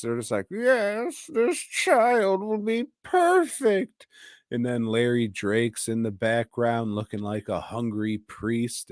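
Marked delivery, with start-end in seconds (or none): 0.5-4.1 much higher, slow and oily; creepy adopter. Retold or imitated. Creepy adopters approving the orphan, high and slow. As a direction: sound like a creepy rich couple sizing up a child. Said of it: "these creepy fucks".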